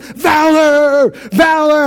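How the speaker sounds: frantic father calling out; retold panicked scream. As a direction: shout for a missing child across a store. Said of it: "I'm screaming at Tom".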